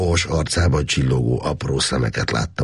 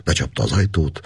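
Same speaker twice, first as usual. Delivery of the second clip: usual speaking voice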